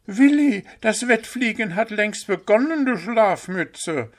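high, loud scolding call. A narrator's reading. Hüpf shouting at the sleeping owl, loud. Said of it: "rief nun auch Hüpf".

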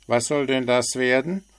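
a chirpy bird poking fun; cheeky little bird. A narrator's reading. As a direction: frecher kleiner Vogel. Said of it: "wollte er wissen".

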